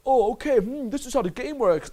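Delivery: high, dopey mimicry of an eager beginner; naive copycat voice. Coach mocking guys who copy a YouTube video, naive mimicry.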